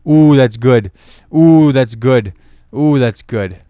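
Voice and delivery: loud, savoring "Ooh, that's good"; self-congratulating, loud; mock delight. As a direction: praise your own joke, hammed up. Repeating his own joke praise.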